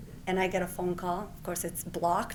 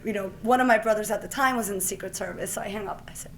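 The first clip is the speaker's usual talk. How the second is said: ordinary talk, nothing marked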